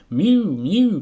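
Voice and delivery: loud high insistent mew; a hungry cat cutting in; plaintive cat cry, loud. Acted. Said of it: "cried Simpkin".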